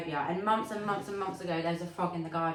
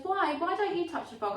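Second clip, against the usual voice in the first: put-on child voice; 8 semitones higher than usual. Voicing toddler daughter.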